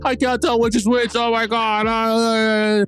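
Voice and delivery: high, mock-bewildered whine; mocking confused character. Mocking the show's twin mix-up, high mock confusion. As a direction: parody a baffled extra. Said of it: "It was like".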